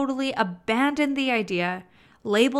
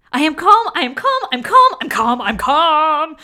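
much louder and higher, frantic calm; tense anxious yelling; frantic not-calm; 5 semitones higher, 11 dB louder than usual. Shown as a demonstration. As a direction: shout it like you're panicking. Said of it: "that did not feel calm at all".

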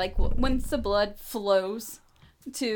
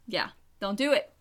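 usual speaking voice

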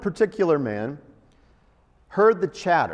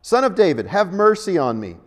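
louder shout; 3 semitones higher, 7 dB louder than usual. Retold or imitated. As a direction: shout it over those hushing him. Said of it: "he shouted even more loudly".

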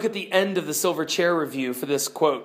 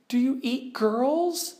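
much higher, anxious pleading; frightened girl Jill; 7 semitones higher, slower than usual. Acted. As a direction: beg for safety nervously. Jill asks fearful question.

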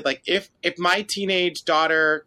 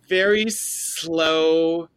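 very slow, each word stretched long; dragged out; much slower than usual. Shown as a demonstration. Podcaster recapping a sluggish scene, dragged out. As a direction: stretch both words right out. Describing painfully slow acting.

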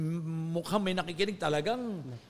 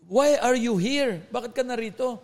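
retold angry demand; 5 semitones higher, 4 dB louder than usual. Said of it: "Galit na galit".

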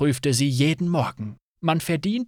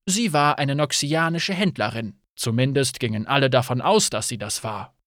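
ordinary delivery

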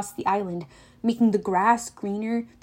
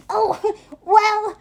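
high, loud flustered bleat; a nervous satyr stalling; squeaky nervous Grover; 10 semitones higher, 4 dB louder than usual. A narrator's reading. Grover as the bride, squeaky and nervous.

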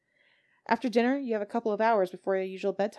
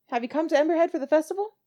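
higher, curious hostess question; lightly voiced landlady, lifted pitch; friendly landlady inquiry; landlady May asking; 8 semitones higher, 4 dB louder, faster than usual.

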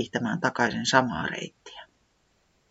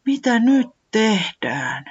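desperate; 8 semitones higher, slower than usual. Acted. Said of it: "Se kysyi epätoivoisena".

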